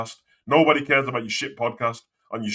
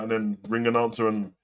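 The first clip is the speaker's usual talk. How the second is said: usual speaking voice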